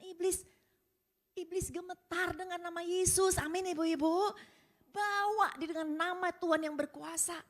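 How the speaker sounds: loud, high, ringing proclamation to the congregation; preaching at full force. Acted. Preacher to the mothers, proclaiming at full force.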